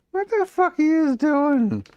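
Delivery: loud, very high shriek of disbelief; incredulous squeal. Real, not acted. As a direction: shriek at the dog in amused shock. Shouting at misbehaving dog.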